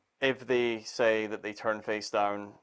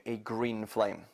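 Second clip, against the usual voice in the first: "a green flame" repeated slowly and a bit softer, leaning on "green"; slow it down and press "green flame" into their mind; emphasis on green flame; 4 dB quieter, slower than usual. Shown as a demonstration. Magician planting the flame colour, slow soft emphasis. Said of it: "I'm adding emphasis to the".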